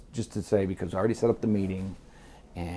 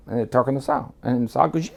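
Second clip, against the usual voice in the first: ordinary delivery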